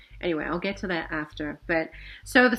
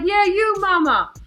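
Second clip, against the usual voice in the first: loud, much higher insistent call; 12 semitones higher, 8 dB louder than usual. Retold or imitated. Guy in the SUV insists she come over, loud and high. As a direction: keep shouting to her from the car.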